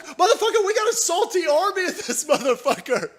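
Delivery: high, cackling through the words; laughing. Real, not acted. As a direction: laugh it out while taunting. Laughing mid-rant.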